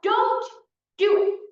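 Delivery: loud, high yelled warning; retold yell. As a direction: call out a warning as if to a friend up high. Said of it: "He yells out".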